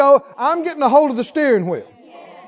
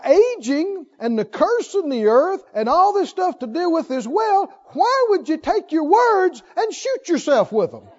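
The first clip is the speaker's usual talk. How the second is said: ordinary delivery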